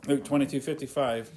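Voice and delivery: usual speaking voice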